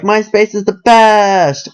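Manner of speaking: high, slow, starry-eyed gush; gushing fan voice. Retold or imitated. Mocking how everybody raved about MySpace, high gush. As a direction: draw out the praise like a fan. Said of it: "We all talk like that".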